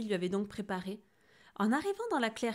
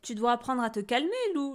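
louder, mildly exasperated scolding; 3 semitones higher, 6 dB louder than usual. A narrator's reading. Exasperated owl master scolding Lou for his temper. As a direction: tell off a tiresome pupil. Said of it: "maître hibou excédé".

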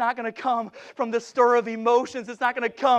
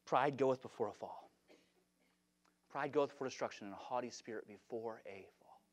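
very low, soft scripture recital; hushed preaching; 10 semitones lower, 15 dB quieter than usual. Real, not acted. Preacher warns church against pride, hushed and low. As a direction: let each phrase fall quietly, with long pauses.

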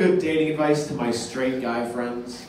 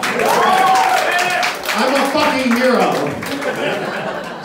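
mock heroic; 8 semitones higher, 4 dB louder, much slower than usual. Mock triumph after coming out.

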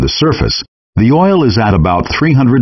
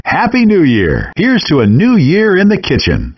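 much higher, upbeat New Year wish; festive cheer; 8 semitones higher than usual. Acted. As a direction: toast the new year with a lively lift. Game host New Year greeting.

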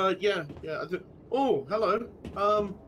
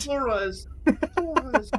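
higher, hammy repeated word; mock announcer voice; 9 semitones higher, 4 dB louder, slower than usual. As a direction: parrot the word in a silly voice. Mocking the sign word.

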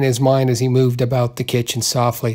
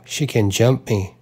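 plain reading voice

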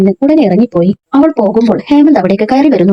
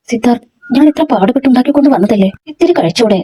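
ordinary talk

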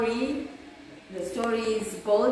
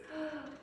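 soft gasp; 5 semitones higher, 13 dB quieter than usual. A narrator's reading. Chair breaks under Goldilocks.

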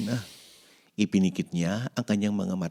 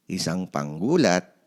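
plain reading voice

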